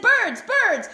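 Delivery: high, loud witch crying out to the birds; witch calling to birds. A narrator's reading. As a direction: shout up to the birds in a panic. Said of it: "she called to the birds".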